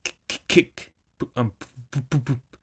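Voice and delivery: suppressed snorting noises, breathy kicks and pops; stifled snorts. A narrator's reading. Toad stifles snorts while Rat lectures him, bottled-up giggles. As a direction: snort it back like fizzing soda water. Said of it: "making strange suppressed noises inside him".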